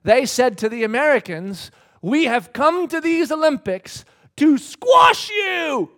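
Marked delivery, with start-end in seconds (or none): 2.0-5.9 loud, high, drawn-out boast; boastful taunt. Retold or imitated. French swimmers taunting the Americans, boastful shout. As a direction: throw down the challenge, slow and loud.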